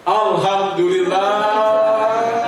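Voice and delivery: long, slow, sung-out cry of thanks; joyful shout. Retold or imitated. Udin relieved to be alive shouts out, long and sung. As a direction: stretch it out like a joyful chant. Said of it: "langsung teriak".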